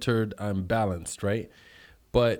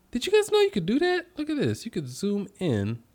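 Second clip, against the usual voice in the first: surprised; 9 semitones higher than usual. Real, not acted. Amazed to find zoom feature.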